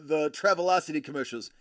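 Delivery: usual speaking voice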